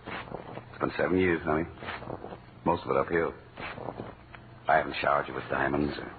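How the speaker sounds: low, soft, sincere confession; tender sincere. Acted. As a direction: speak softly from the heart.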